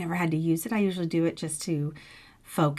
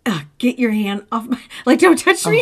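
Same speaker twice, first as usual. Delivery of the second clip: higher, louder mock snap of annoyance; irritated recoil; 5 semitones higher, 7 dB louder than usual. Nauseous wife mock-snapping at husband's comforting hand. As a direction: push the touch away with a hammed-up groan. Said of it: "this warmth that was like".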